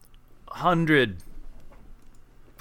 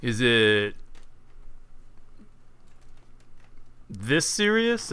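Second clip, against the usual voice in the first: sly mock bribe; slower than usual. Player offers guard a bribe.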